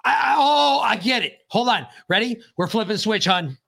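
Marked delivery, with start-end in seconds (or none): none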